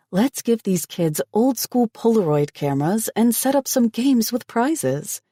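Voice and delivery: high eager pitch, slowing; excited. A narrator's reading. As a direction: enthuse about the games. She keeps pitching eagerly.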